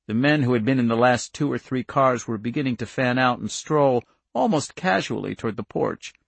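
usual speaking voice

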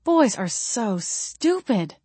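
drawn out, slow and weary; mildly exasperated, long-drawn words; exasperated sigh. A narrator's reading. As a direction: sigh it out slowly. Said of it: "I sighed".

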